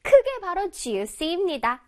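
ordinary talk

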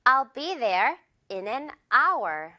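very slow I'll be there in an hour., silent h in hour; slow model sentence. Shown as a demonstration. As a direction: drill it slowly for the class. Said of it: "바로 갑니다". (천천히 따라 읽기 예문)

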